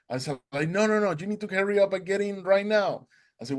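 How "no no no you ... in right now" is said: high, insistent hurrying; retold urgency. Boatman hurries swimmer aboard, urgent high voice. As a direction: imitate an impatient order, pitched up.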